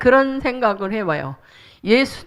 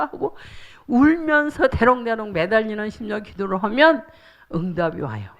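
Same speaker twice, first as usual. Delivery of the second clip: ordinary talk, nothing marked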